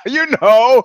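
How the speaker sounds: words through laughter; laughing. Real, not acted. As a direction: still laughing as you talk. Laughing after punchline.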